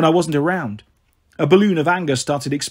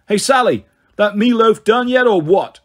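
loud call from the other room; 6 semitones higher, 5 dB louder than usual. A narrator's reading. Gabe yelling to Sally from the living room for dinner. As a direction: shout it across the apartment, demanding.

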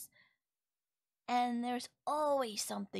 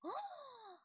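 thrilled gasp; 12 semitones higher, 10 dB quieter than usual. Acted. Told what sounds listener likes.

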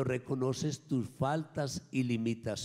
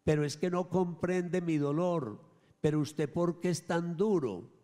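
hurt complaint; 8 semitones higher, 5 dB louder than usual. Retold or imitated. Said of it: "Y alguien dirá".

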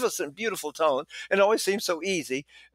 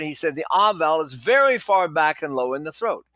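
ordinary talk, nothing marked

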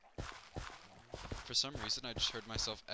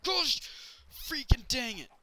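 much higher, loud yell of annoyance; frustrated outburst; 14 semitones higher, 10 dB louder than usual. Real, not acted. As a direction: burst out yelling at the game. Mishap while talking.

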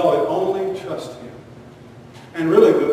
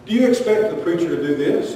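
ordinary talk, nothing marked

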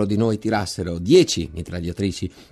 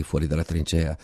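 ordinary delivery, nothing marked

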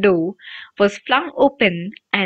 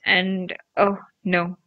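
a low, quiet groan of dismay; 3 semitones lower, 4 dB quieter, slower than usual. A narrator's reading. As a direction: sink into an embarrassed groan. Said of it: "he groaned".

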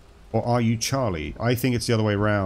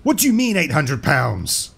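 high, slow mock-outraged shout; top-of-voice response; 6 semitones higher, 4 dB louder than usual. Man in a bar joke answering the woman, shouting. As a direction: shout the punchline, stretched out and indignant. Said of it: "responds at the top of his voice".

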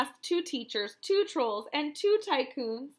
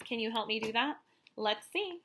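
plain reading voice

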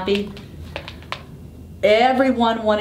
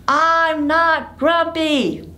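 high, slow, drawn-out denial; high, slow and sulky; grumpy monkey insisting; 11 semitones higher, much slower than usual. Acted. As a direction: stomp out each word with the kids. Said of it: "Say it with me".

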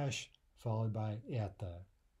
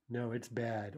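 ordinary talk, nothing marked

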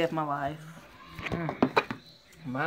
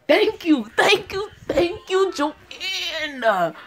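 loud, high-pitched thank-yous; elated outburst; 12 semitones higher, 3 dB louder, much slower than usual. Real, not acted. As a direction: cry out gratitude with drawn-out last word. Reacts with relief to reveal.

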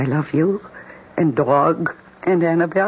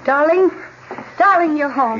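high, bright calling out to him; delighted call; 3 dB louder than usual. Acted. Wife calling to husband as he comes home, high and delighted. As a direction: sing out his name as he walks in.

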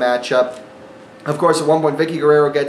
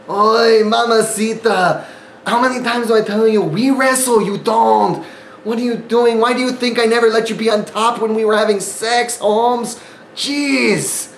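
a higher, hammed-up fake Mexican accent, scolding; a badly done Mexican accent, playfully chiding his wife; Eddie Guerrero Mexican accent; 5 semitones higher than usual. A husband mock-scolding his wife from the ropes, in a bad Mexican accent.

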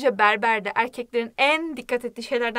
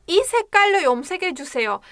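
이 색깔로 염색해주세요 rattled off very fast, a bit higher; rapid model sentence; 4 semitones higher, much faster than usual. Shown as a demonstration. Teacher showing the natural fast phrase. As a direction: run 이 색깔로 염색해주세요 together at full speed. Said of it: "Diyorum ki".

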